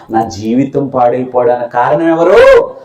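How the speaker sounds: normal talking voice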